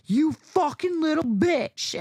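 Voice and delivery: slow, high, loud mock snarl; man in her face, hammed-up slow snarl; menacing stranger. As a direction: spit it like a street thug. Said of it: "tries to grab me and goes".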